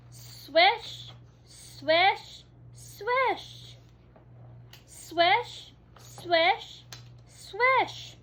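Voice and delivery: high, loud swishing; rhythmic swish sounds. Acted. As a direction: swing the words like a net. Net swinging sound effects.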